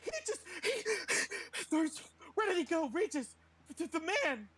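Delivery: high, stammering woman in a panic; put-on high voice, breaking off mid-phrase; mimicking her voice. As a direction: stammer it out high, as a flustered character. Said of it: "I'm mimicking her voice".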